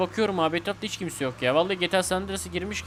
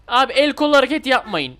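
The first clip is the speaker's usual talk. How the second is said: loud, higher exasperated yell; exasperated taxi driver yelling at passengers; frustrated shout; 5 semitones higher, 6 dB louder than usual. Real, not acted. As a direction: shout at them to stop waving their arms.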